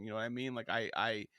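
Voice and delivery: usual speaking voice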